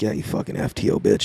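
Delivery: voiceless whisper, barely audible insult. Retold or imitated. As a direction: hiss it under your breath at the cop. Muttered insult at trainee cop.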